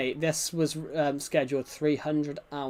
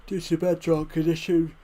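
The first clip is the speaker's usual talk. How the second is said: louder put-on silly voice; goofy mocking voice; 4 dB louder than usual. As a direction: parrot the phrase in a funny voice. Repeats in odd voice.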